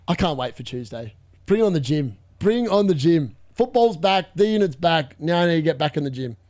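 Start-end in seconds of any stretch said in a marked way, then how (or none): none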